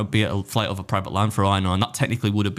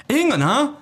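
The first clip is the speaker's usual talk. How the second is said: much higher mock American man. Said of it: "He's like".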